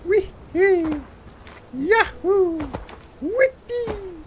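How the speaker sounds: very high cartoon-hero whoops; playful whooping. Gamer bounding down the mountain like Mario, playful high whoops. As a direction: squeal out short, gleeful jumping cries.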